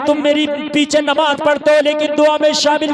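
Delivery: loud preacher's exhortation; loud sermon cry; fiery preaching. Acted. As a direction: thunder it to the crowd. Preacher at full force.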